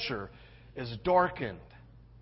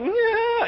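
high, drawn-out uneasy "yeah"; awkward squirm noise; 3 dB louder than usual. Retold or imitated. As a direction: squeal it like squirming, embarrassed boys. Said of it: "they're like".

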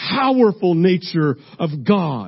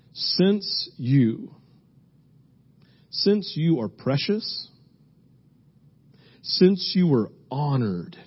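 slow, soft scripture reading with long pauses; hushed, measured verse reading; 4 semitones lower, 5 dB quieter than usual. Real, not acted. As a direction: read it slowly and quietly, pausing between phrases to let each sink in. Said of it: "I'm gonna read this slowly".

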